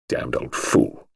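low, slow, suppressed anger; angry. A narrator's reading. Husband cursing the old gardener, low and angry. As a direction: curse him slowly under your breath. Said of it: "said Gerald angrily".

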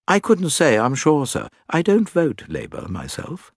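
proper English manservant; respectful manservant. Acted. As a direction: answer firmly but deferentially, as a valet.

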